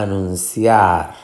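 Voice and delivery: anunciar with CIAR stressed and long; final stress. Shown as a demonstration. Teacher showing the word's rhythm, anun-CIAR. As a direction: hit CIAR at the end of anunciar. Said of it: "ciar es la sílaba".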